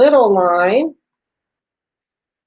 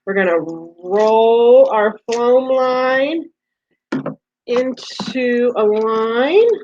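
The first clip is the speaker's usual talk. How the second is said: slow teaching; slower than usual. Shown as a demonstration. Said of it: "let's go down here".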